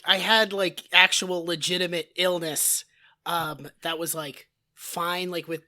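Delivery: blown-out raspy voice; hoarse voice. Real, not acted. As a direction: speak through a shredded throat. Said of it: "husky, raspy, sexy voice".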